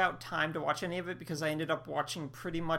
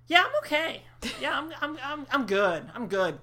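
mock polite refusal; 8 semitones higher than usual. Mock declining to watch show.

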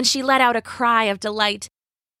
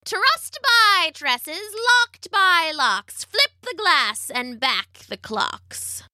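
slow, high incantation; witch casting a spell; 8 semitones higher, much slower than usual. A narrator's reading.

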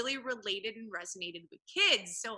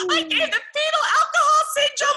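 panicked laughing shriek, much higher and louder; freaking out; 19 dB louder than usual. Retold or imitated. Telling the host how she panicked at the dinner table, laughing high shriek. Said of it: "Freaking out".

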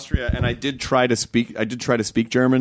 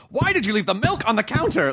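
high, mock-stern scolding as if pounding a fist; mock German scolding; 11 semitones higher than usual. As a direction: scold like an angry German over the milk. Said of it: "pounding your fist and going".

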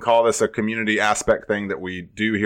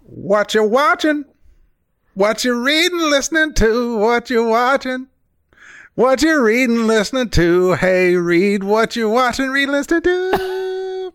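high falsetto sing-song; jingle voice impression; 10 semitones higher than usual. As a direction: chant the questions up high. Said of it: "when you don't get the voice".

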